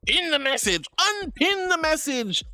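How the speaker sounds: high-pitched imitation of someone giving orders; mock bossy Lawrence. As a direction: mimic a bossy chatter in a high voice, stretching the second order. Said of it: "Lawrence, like, telling me what to do".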